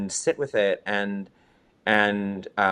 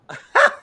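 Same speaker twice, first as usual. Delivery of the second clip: high bright burst of laughter; a quick, delighted squeal of a laugh; happy laugh; 6 dB louder than usual. Real, not acted. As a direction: laugh out loud at yourself.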